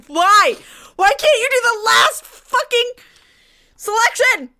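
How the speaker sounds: loud, high, wailing complaint; exasperated yelling. Real, not acted. Player failing the last selection, anguished yelling. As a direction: cry out at the useless controller.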